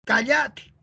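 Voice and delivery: "Cállate" stressed on the middle, ca-LLA-te; Argentine verb stress. Shown as a demonstration. Teacher imitating how Argentinians say it, "Cállate" stressed as ca-LLA-te. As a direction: say "Cállate" as calláte, leaning on LLA. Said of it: "Van cambiando la pronunciación de los verbos".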